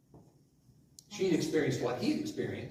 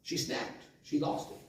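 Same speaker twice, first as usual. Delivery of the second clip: whispered; 7 dB quieter than usual. Real, not acted. Preacher hushes for effect.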